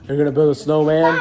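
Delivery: excited. Real, not acted. Excited question to small child.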